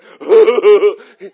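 long, loud acted holler; big drawn-out exclamation; loud whooping outburst. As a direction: let out an amazed whoop, re-enacted. Acts out his amazed reaction.

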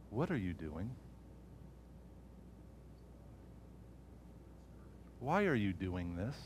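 doubting voice. Retold or imitated. Enemy's voice in his head.

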